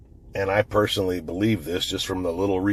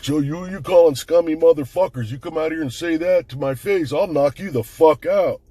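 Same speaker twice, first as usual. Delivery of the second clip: higher, louder retold threat from the neighbor; mock tough-guy threat; 8 semitones higher, 4 dB louder than usual. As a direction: puff up and threaten him, imitating the big neighbor. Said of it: "Mister Goy's like".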